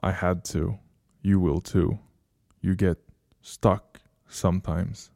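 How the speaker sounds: soft, halting admission; almost sad. A narrator's reading. Stranger explaining himself to the one left behind, soft and faintly sad. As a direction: confess it quietly, with a touch of regret. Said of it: "another almost sad look".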